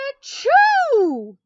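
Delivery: loud high acted sneeze "achoo"; bear sneeze. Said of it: "he sneezes".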